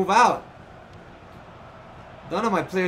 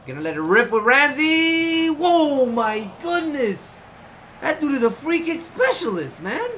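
thrilled; 7 semitones higher than usual. Real, not acted. Free kick goes in.

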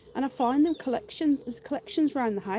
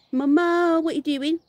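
calling to pet; 7 semitones higher, 6 dB louder than usual. Real, not acted.

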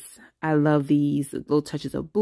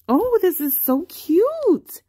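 much higher and louder, slow, gushing squeal of delight; 5 dB louder, slower than usual. Real, not acted. Vlogger squealing over a cute sticker page, gushing delight. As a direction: squeal it out like you just found the cutest thing.

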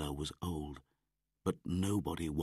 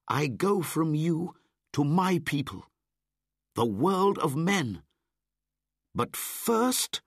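put-on high, loud, proud boy; boy hero; 12 semitones higher, 6 dB louder than usual. Mowgli telling the wolves he leaves, proud. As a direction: announce your leaving proudly.

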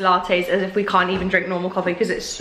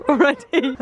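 ordinary delivery

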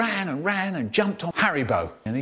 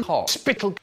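plain reading voice

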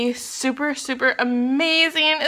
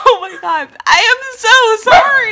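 much higher, louder laughing apology; 11 semitones higher, 11 dB louder than usual. Real, not acted. Vlogger laughing as she apologises to viewers for the dog.

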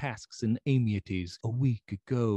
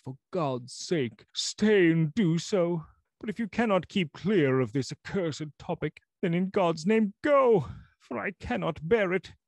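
begging in anguish, then breaking out; anguished pleading; 10 semitones higher, 5 dB louder than usual. Acted. Lanyon begs Utterson to leave.

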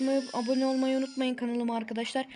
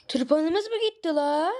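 higher and slow, taken aback; surprised slow question; 6 semitones higher, 4 dB louder, slower than usual. Real, not acted. Gamer to viewers after losing the scythe, slow and surprised. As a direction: drag it out in disbelief.

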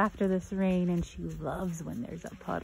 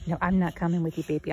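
ordinary delivery

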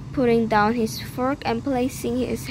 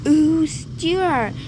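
1 disappointed cry; 5 semitones higher, 4 dB louder than usual. A narrator's reading. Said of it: "cried the disappointed mother and dad".

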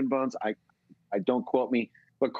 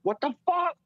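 shocked disbelief. Retold or imitated. Reacting to Charlie's news, a high shocked yelp. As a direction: shriek it in total astonishment. Learns Charlie lived in his town.

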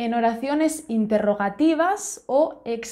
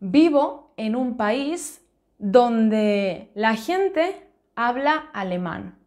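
slow example; slower than usual. Shown as a demonstration. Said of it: "Por ejemplo".

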